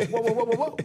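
backing off. Retold or imitated. Host fending off critics, defensive whoa. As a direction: hold up hands and back off. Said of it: "I'm like".

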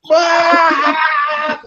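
high, loud scream of laughter; burst of laughter. Retold or imitated. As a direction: let out a sudden shriek. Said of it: "it was like".

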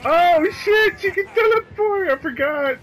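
high, loud frightened scream; panicked shriek. Real, not acted. Killer teleports next to her.

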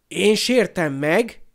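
loud, slow, outraged question; stretched-out cry of offended disbelief; yelling. Acted. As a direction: raise pitch and volume in indignation. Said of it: "ordított Seamus".

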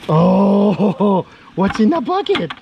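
high sing-song talk to a pet. Real, not acted. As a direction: coo at the lizard with a laugh. Baby talk to pet lizard.

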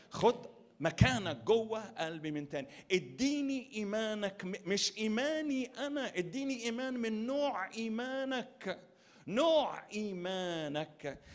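loud, high pleading to God; fervent prayer. Acted. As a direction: cry it out as a prayer.

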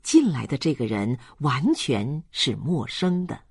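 ordinary delivery

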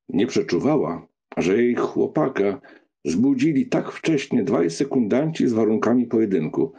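ordinary delivery

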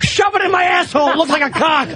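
high, shouted mock demand; over-excited mock yell; hammed-up eager shout. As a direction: yell it with frantic comic eagerness. Acted quote of over-eager man.

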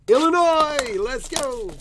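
high, loud shout of the name; loud hype call. Real, not acted. Hyped shout naming the card's city. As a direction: shout it out to the room.

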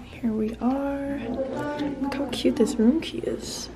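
slow, hushed whisper. Real, not acted. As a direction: whisper as you open the door. Whispering at the room door.

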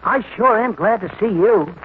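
butter her up, overly nice; fake sweetness. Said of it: "can this be me talking?".

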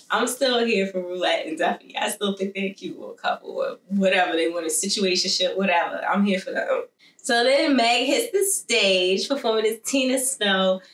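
ordinary talk, nothing marked

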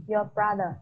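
usual speaking voice

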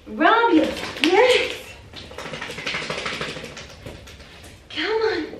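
very high cooing coax. Real, not acted. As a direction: coax the fluffy puppy, laughing. High coaxing with laughs.

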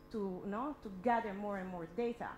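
ordinary talk, nothing marked

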